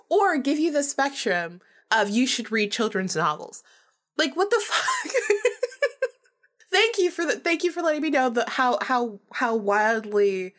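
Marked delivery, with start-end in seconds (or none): 4.2-5.1 much higher, laughing through the curse; half-laugh, half-squeal of disbelief. Real, not acted. Retelling the caller's strange compliment, laughing disbelief. 6.7-10.5 sarcastic thanks. Retold or imitated. Mock thanks to caller.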